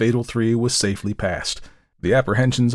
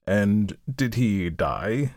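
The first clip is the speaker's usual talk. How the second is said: slow, drawn-out question rising at the end; nice little man; 4 dB quieter, much slower than usual. A narrator's reading. Little man in gosling green questions the storyteller, slowly. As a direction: ask it very slowly, in character. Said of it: "said the nice little man in gosling green".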